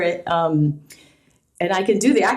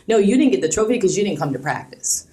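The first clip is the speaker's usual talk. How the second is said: usual speaking voice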